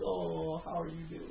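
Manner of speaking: soft doting coo to the family dog. Retold or imitated. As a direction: coo at the dog like it's a baby. Husband coos to the dog.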